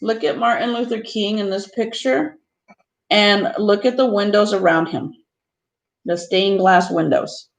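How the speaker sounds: expressive picture book reading; reading to children. Acted. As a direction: read the book to the class with gentle emphasis. Teacher reads picture book aloud.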